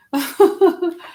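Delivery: high, loud laugh out of nowhere; real amused laugh; burst of laughter. Real, not acted. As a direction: laugh it off at yourself. Laughs at needing prayer.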